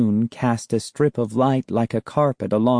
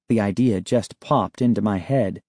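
plain reading voice

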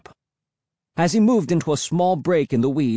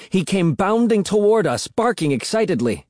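ordinary delivery, nothing marked

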